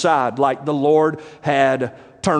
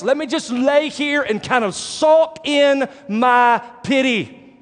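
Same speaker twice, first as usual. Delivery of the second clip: slow, higher-pitched acted wallowing; self-pitying sulk; 8 semitones higher, slower than usual. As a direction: draw the words out as if sulking in self-pity. Voices a depressed person wanting solitude.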